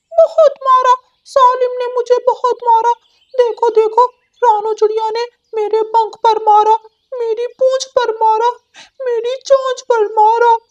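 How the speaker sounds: high, loud wailing complaint; crying. Acted. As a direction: whine like a beaten, weeping parrot. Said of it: "तोता रोता हुआ".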